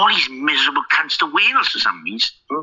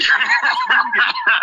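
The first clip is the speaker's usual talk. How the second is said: burst of laughter. Real, not acted. Reacting to his co-host's story about Craig, laughing helplessly.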